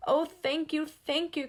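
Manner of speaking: high, loud thanks; grateful cry. A narrator's reading. Gus thanks the old ghost, high grateful cry. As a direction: gush with relief. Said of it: "cried Gus".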